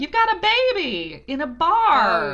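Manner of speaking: quoting the film in a high, loud Southern twang; hammed-up Southern accent; mock Southern movie line. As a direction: belt it like the Sweet Home Alabama bar scene. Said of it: "where they're like".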